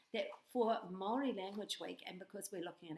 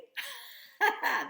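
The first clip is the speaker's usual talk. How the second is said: high giggle; 12 dB louder than usual. Real, not acted. Laughs at own joke.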